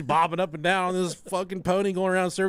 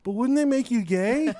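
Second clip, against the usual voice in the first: put-on higher child asking; the daughter asking her mom, voiced higher; 8 semitones higher, 4 dB louder than usual. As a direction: lightly voice the curious daughter. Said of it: "She said".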